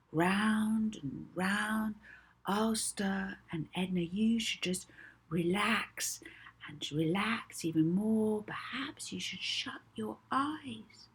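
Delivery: slow hypnotic lull sinking to a soft whisper; hypnotist's croon that melts into a whisper; soothing hypnotic voice fading to a whisper. Acted. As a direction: ease her eyes shut, dropping to a whisper. Molly hypnotising Edna, soothing.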